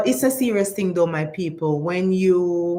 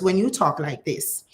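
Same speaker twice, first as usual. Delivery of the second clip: ordinary delivery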